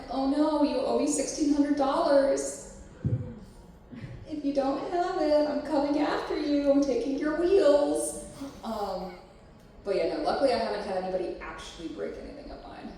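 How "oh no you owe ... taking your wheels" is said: mock threat. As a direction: play the joking debt collector. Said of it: "And I'm like".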